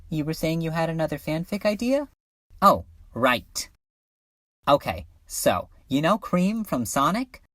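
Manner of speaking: ordinary talk, nothing marked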